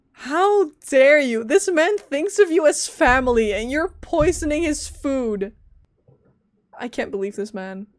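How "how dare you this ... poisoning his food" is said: loud, indignant outburst; real mock-furious rant; outraged. Real, not acted. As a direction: scold the villain hard. Outraged at game villain.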